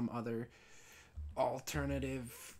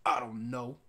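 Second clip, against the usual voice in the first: grumpy clerk; 6 dB louder than usual. Retold or imitated. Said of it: "And he'd be like".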